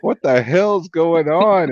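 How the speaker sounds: alarmed confusion. Retold or imitated. Recalling finding both friends on the ground, loud slow alarmed question. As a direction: drag it out, loud and baffled at the scene.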